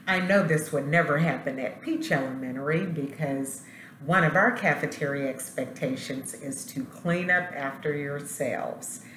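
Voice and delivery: plain reading voice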